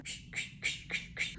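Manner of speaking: soft breathy ksh ksh of footsteps; imitated crunching footsteps. Imitating the footsteps she heard from behind the tree, softly. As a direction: mimic the crunch of leaves underfoot. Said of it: "And then I just hear".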